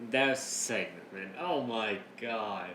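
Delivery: ordinary delivery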